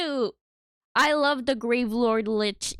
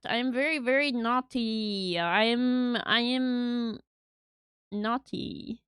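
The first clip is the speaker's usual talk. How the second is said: usual speaking voice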